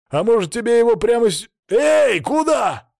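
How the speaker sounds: high, loud startled shout. A narrator's reading. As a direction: yell after someone running off. Guard shouts after fleeing hero.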